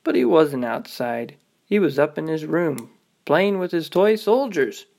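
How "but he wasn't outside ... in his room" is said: ordinary delivery, nothing marked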